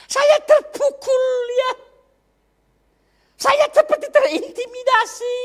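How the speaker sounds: loud, high, impassioned sermon voice; fervent preaching. Acted. Preacher confessing to the congregation how rejection hurt, preaching at full force. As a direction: preach it out, pouring your heart into the testimony.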